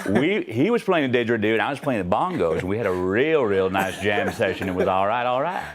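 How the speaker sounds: laid-back storyteller with swooping intonation; McConaughey. Shown as a demonstration. Guest spinning a bongo jam story on request, easygoing McConaughey impression. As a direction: lazily roll out the tale, lingering on key words. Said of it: "can we have McConaughey, please?".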